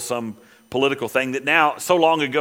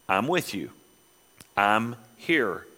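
much softer and lower, tender; soft reassurance; 5 semitones lower, 5 dB quieter, slower than usual. Acted. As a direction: comfort them quietly. Soft reassurance after scripture.

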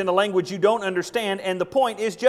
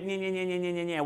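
mocking nya nya; 6 dB quieter than usual. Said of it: "you might as well speak".

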